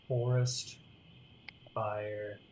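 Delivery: soft, low murmur to herself; muttering search. Real, not acted. GM quietly searching for forest fire music, soft. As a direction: coax the playlist under the breath.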